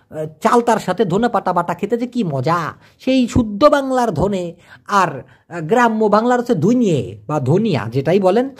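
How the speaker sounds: ordinary delivery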